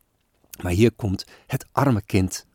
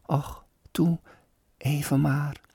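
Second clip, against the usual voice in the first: put-on higher, softer pleading little girl; begging child; 7 semitones higher, 4 dB quieter than usual. Sick girl begs her father, higher and soft. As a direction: beg softly like a frail child.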